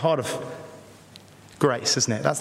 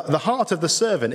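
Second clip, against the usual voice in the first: ordinary delivery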